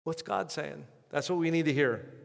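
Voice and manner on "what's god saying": hushed question. Acted. Preacher repeats question, loud then hushed.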